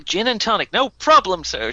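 bubbly American cabin crew; enthusiastic American stewardess. Acted. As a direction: gush it like an overkeen American flight attendant. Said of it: "she says again enthusiastically".

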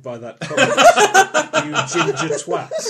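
laughing. Real, not acted. Mock outrage while laughing.

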